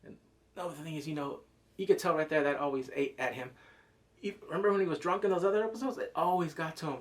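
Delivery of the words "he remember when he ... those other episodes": usual speaking voice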